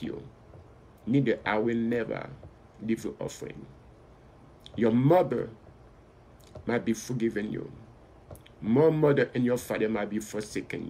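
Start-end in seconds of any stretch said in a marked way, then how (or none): none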